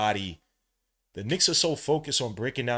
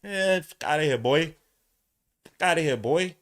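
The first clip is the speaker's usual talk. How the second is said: raised, cocky send-off in a player's voice; mock dismissive quote; 5 semitones higher, 5 dB louder than usual. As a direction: wave them away, playfully dismissive. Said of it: "throwing up the deuces like".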